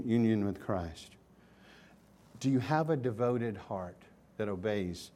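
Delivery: ordinary delivery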